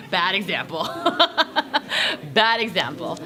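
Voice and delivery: embarrassed chuckle around the words; laughing. Real, not acted. Embarrassed no one watched video.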